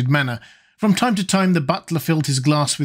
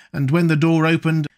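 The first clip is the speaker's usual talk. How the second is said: ordinary delivery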